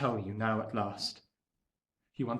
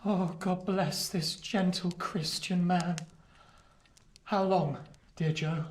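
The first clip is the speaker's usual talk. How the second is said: tender blessing; 5 semitones higher, slower than usual. Acted. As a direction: breathe it out slowly with gratitude. Pip's tearful thanks.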